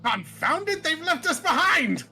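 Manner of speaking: high indignant outburst; exasperated seer. Acted. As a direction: cry out in theatrical vexation. Seer exclaims at being abandoned.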